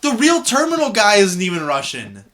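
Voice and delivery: loud, high-pitched protest; incredulous outburst. Real, not acted. Co-host objecting that the casting makes no sense, incredulous outburst. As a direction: protest in amused outrage. Outraged on hearing of Russian accent.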